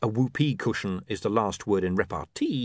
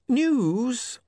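William's voice; 13 semitones higher, slower than usual. Acted. William explaining.

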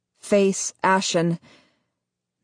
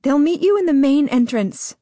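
higher, firm, guiding voice; guiding woman's voice; 8 semitones higher, 4 dB louder than usual. Acted. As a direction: steer him through it calmly. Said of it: "Ella guides me".

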